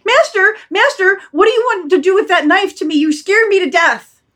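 high, loud panicked pleading; scared dog yelping at its master; frightened. Acted. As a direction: cry out as the dog fleeing a knife.

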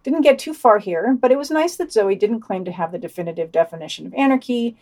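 ordinary talk, nothing marked

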